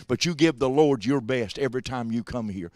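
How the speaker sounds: normal talking voice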